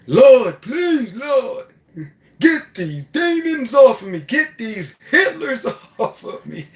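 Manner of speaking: high, loud, slowed pleading with a laugh; panicked prayer. Pretend. Pulled-over driver begging God to remove the demons, panicked. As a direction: beg the Lord in mock terror. Frightened driver praying aloud.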